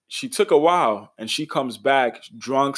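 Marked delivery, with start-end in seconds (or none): none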